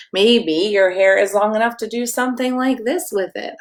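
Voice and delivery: ordinary delivery, nothing marked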